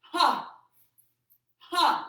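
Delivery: sharp loud Ha shouts; explosive breath-out shout; forceful shout. Shown as a demonstration. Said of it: "Here we go".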